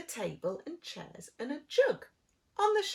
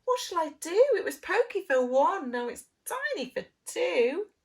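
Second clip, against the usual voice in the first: higher, louder fretful complaint; fretting old lady; 7 semitones higher, 7 dB louder than usual. Acted. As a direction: complain of the cramped house. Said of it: "The little old lady cried".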